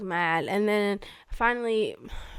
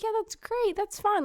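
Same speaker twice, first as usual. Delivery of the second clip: higher, forced happy tone; fake cheer; 12 semitones higher than usual. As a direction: sound happy while hurting. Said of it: "pretending I was, like, happy".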